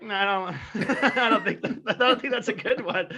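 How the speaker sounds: mock test audience turning it down; test audience reaction. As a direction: laugh the dismissal out. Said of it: "test audiences were like".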